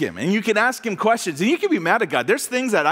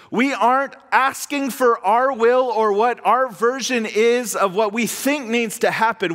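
ordinary talk

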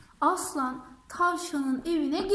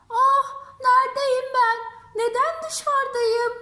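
crying; 7 semitones higher, 4 dB louder than usual. Acted. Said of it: "diye ağlamaya başlamış".

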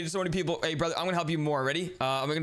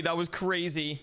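ordinary talk

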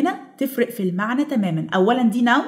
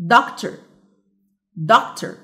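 doctor with DOC leading, the end dropped; correct first-syllable stress; much slower than usual. Shown as a demonstration. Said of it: "which has the correct".

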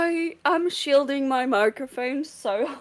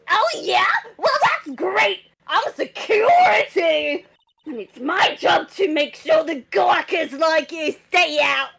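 put-on screaming old guard. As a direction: shout them down from the gate. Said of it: "I will be screaming".

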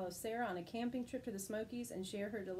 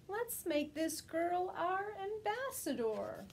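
called out; 8 semitones higher, slower than usual. Acted. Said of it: "someone called amid the uproar".

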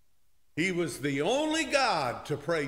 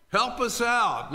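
loud, high cry for help; desperate plea; 8 semitones higher, 5 dB louder than usual. Acted. Sailors begging Jonah, loud high plea. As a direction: call out for help.

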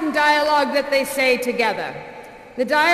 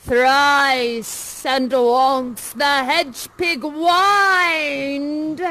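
slow, drawn-out witch incantation; second witch; much slower than usual. Acted. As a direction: intone it theatrically as a witch. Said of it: "the second witch enters and says".

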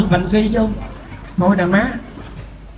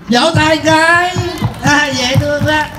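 higher, drawn-out singsong call; playful crowd-coaxing; 5 semitones higher, slower than usual. Acted. Tipsy husband asking the audience to clap, playful drawn-out call. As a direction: coax the crowd with slow, stretched words.

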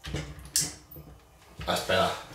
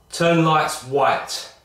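slow, clear command to a smart speaker; voice assistant command; 6 semitones higher, 3 dB louder, much slower than usual. Real, not acted. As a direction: spell it out slowly for the speaker. Voice command to Google Home.

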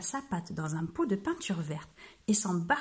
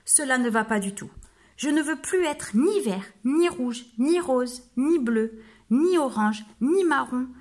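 louder, rising, exasperated list; at the end of his tether; 3 semitones higher, 5 dB louder than usual. Acted. Exasperated wolf telling the mirror he's had enough. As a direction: spit out each colour, fed up. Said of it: "à bout de nerfs".

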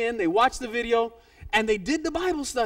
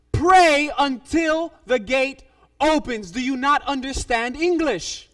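very slow, loud "Pray until the gate opens"; exasperated insistence; 2 semitones higher, 6 dB louder, slower than usual. Retold or imitated. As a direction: hammer out "Pray until the gate opens" as if she can't understand. Pastor exasperated at her questions.

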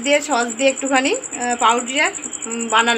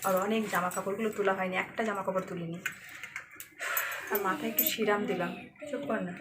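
much softer near-whisper; 3 semitones lower, 13 dB quieter than usual. Real, not acted. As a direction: keep it very soft, the daughter is asleep. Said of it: "খুব আস্তে কথা বলছি".

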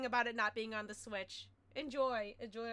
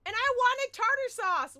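loud whiny complaint; 10 semitones higher, 10 dB louder than usual. Real, not acted. Frustrated outburst over game.

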